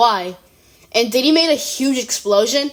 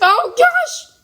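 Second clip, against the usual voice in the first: much higher, delighted gasp of a line; excited; 3 dB louder than usual. Real, not acted. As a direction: squeal it in disbelief. Reacting to chaos on screen.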